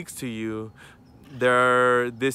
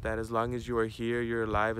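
ordinary talk

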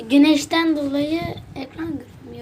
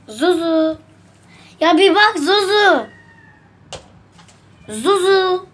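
calling the cat by name; raised voice calling a pet; 4 semitones higher, slower than usual. Real, not acted.

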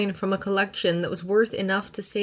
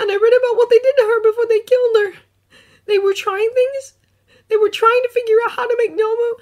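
shaky voice; 12 dB louder than usual. Acted. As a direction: voice trembling, close to tears. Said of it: "his voice shakes".

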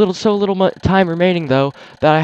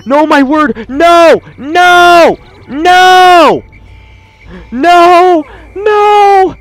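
high, loud, desperate cries of no; panicked screaming; 10 semitones higher, 7 dB louder, much slower than usual. Real, not acted. Boss breaks loose, screaming no.

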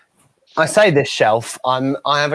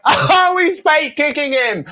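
very high mock delight; hammed-up, drawn-out spectator glee; amused audience; 12 semitones higher, 4 dB louder, slower than usual. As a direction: squeal like a crowd enjoying the act. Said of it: "And laughing, going".

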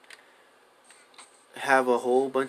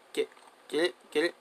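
ordinary talk, nothing marked

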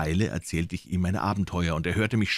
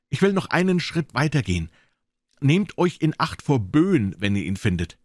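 higher-pitched, deliberate caution to the cook; warning doctor; 9 semitones higher, 5 dB louder than usual. Acted. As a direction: give a pointed warning. Doctor warns Silver.

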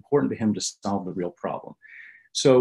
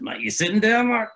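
much higher, playful New Yorker; Jimmy's teasing voice; 13 semitones higher, 4 dB louder than usual. Retold or imitated. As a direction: drag out the suspense.

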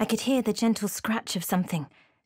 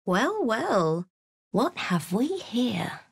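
sly menace; 4 semitones higher, slower than usual. Acted. Sly villain greeting.

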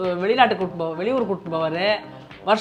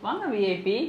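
soft, teasing mock welcome; கிண்டல்; 9 dB quieter than usual. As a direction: welcome a VIP with quiet mockery. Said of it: "நக்கலா கலாய்க்கிறேன்". (நக்கலான மெல்லிய வரவேற்பு)

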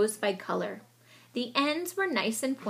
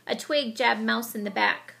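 usual speaking voice

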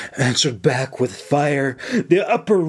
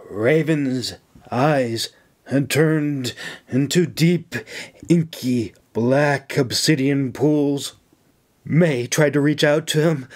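ordinary delivery, nothing marked

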